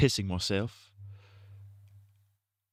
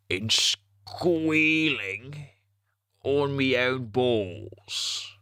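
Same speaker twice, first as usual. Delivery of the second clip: higher, very slow put-on voice; silly squeal voice; 3 semitones higher, much slower than usual. Streamer riffing to chat in a slow goofy squeal.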